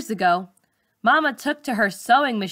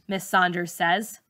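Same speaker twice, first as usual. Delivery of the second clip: plain reading voice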